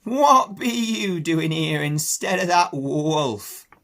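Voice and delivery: put-on an old country sheep croaking; Ma the old ewe questioning the pig. Ma speaking.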